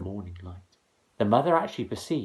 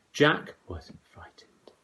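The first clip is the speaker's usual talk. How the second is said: usual speaking voice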